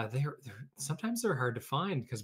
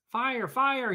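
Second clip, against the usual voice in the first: high, loud alarm call; cat crying fire; 12 semitones higher, 7 dB louder than usual. Acted. Jack tries to scare the birds off, loud high alarm. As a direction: shout the false alarm. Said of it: "he cried".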